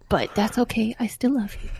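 soft playful whisper; whispered aside. Pretend. Teasing her old friend Chris after blaming him, playful whisper. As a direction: breathe it like a secret.

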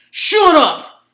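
loud, high mock yell; imitating Carmine's yell. Carmine yelling at his mother as expert starts, mock shout. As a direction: burst out shouting, playing a rude heckler. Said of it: "Carmine yells".

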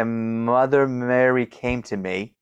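slow, gliding into the M of Mother; elided M. Shown as a demonstration. Showing the listener a stutter technique, eliding the M. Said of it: "do an elided M".

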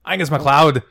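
loud cartoon Scottish accent. Shown as a demonstration. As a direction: bark it out in a hammed-up Scottish accent. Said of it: "I have to think of Scrooge McDuck".

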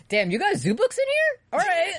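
high, laughing mock soldier voice; mock Major Miles. Imitating Major Miles at the library, high and laughing. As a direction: squeak it out while laughing.

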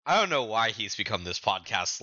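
normal talking voice

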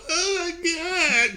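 high, slow hammed-up sob; mock cry. Girl crying at angry guardian, slow high mock sob. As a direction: drag it out as a theatrical whimper. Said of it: "cry was very fucking funny to me".

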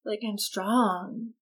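mock swooning whisper. Bella marveling at vampires to Jacob, hushed mock swoon. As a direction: sigh it like a lovestruck teen.

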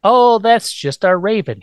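a lightly voiced airy reassurance; Lily's breezy hostess. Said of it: "Lily voiced hosting the teacher".

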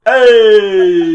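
hissing feral vampire Fonzie cry; a loud Fonzie hey with vampire fangs. Pretend. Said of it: "do the Fonzie "Hey" with a vampire voice".

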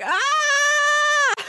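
sudden high yelp of fright; startled shout. Retold or imitated. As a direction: let out a sharp scared "Ah!". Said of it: "And then I was like".

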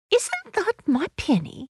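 put-on high-pitched little girl; child's voice. Lucy spotting her own clothes, high child's voice. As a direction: ask high, like a small child.

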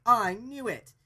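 a sharp, loud, high "I knew it"; half-mocking triumphant blurt; mock outburst. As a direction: play the husband pouncing on the proof, in a hammed-up way. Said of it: "the politician husband's like".

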